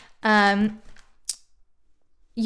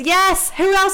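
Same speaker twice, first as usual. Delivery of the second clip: excited yes; 8 semitones higher, 7 dB louder, slower than usual. Real, not acted. Answering a chat question eagerly.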